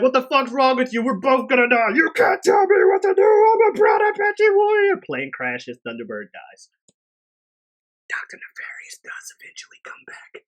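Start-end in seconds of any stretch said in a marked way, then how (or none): 0.0-5.0 high, loud hammed-up panic rising to a shriek; cartoonish terror, much higher and louder; mock panicked pilot. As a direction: scream at the madman on your plane. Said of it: "the dude's like".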